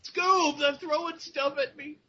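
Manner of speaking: high cartoon-sidekick whine; mock-alarmed, high-pitched complaint; Shaggy. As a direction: call to your dog pal in cartoon panic. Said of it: "Shaggy, help me out here".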